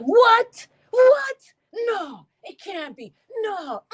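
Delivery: high loud what, what, then trailing no; reliving the shock in a mock cry; shocked disbelief. Streamer reenacting shock at the news, high disbelieving cry. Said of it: "I was like".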